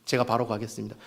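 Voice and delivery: soft, low humble yes; obedient reply. Acted. Samuel humbly answers God, soft obedient voice. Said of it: "어떻게 대답해야 되겠습니까".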